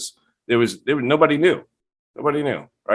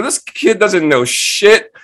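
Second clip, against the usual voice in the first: high, slow mocking scorn; scoffing; 10 semitones higher, 5 dB louder, slower than usual. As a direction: drag it out with contempt. Scoffing at younger roommate.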